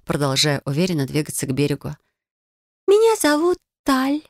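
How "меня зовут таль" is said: high mermaid voice; a shy mermaid giving her name. Acted. Mermaid introduces herself.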